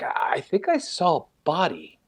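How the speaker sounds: retold bewilderment, stumbling on the first word; imitating confusion. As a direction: lightly act out a friend unsure what he saw. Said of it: "confused, as if, like, he doesn't know".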